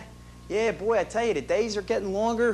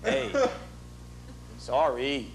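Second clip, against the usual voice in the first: a lightly voiced crooner, low and offhand; 6 semitones lower, 4 dB quieter, slower than usual. Imitating the singer apologizing at the bar, lightly done. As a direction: toss off the apology like a smooth star. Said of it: "He goes, uh".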